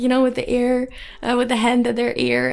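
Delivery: ordinary talk, nothing marked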